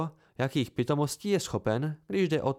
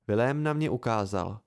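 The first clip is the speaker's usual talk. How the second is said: plain reading voice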